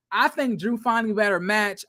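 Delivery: ordinary talk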